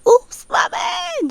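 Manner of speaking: very high, slow sobbing cry; crying shout. Retold or imitated. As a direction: cry it out, breaking apart. Just crying.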